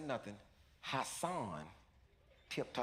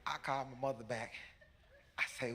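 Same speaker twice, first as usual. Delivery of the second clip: ordinary delivery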